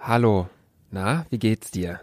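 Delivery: deep, mock-soft greeting; silly voice. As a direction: put on a dopey low voice. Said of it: "Hör auf, so dumm zu reden". (alberne Stimme)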